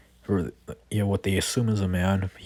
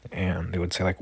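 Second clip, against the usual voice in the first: ordinary talk, nothing marked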